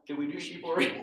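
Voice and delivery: plain reading voice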